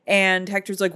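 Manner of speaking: ordinary delivery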